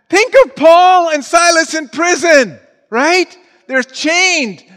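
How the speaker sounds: high, loud exhortation to the congregation; fired-up preaching. Real, not acted. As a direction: shout it out to rouse a sleepy church. Preacher rouses congregation at full force.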